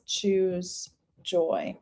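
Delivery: spoken slowly to oneself while noting it; saying while writing. Real, not acted. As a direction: stretch each word as you jot it down. Writing down the phrase while speaking.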